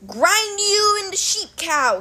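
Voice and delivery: slow, high, menacing giant voice; hammed-up Cyclops threat. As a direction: promise to crush them. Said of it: "he promised".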